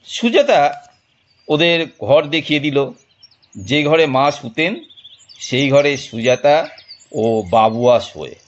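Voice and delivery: ordinary talk, nothing marked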